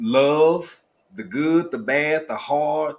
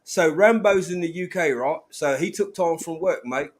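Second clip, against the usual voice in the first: hammed-up mock British accent, pitched up; udawany brytyjski akcent; 5 semitones higher, 3 dB louder than usual. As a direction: tease the UK friend in a put-on British accent. Mock British, "bruv", "mate", listeners laugh.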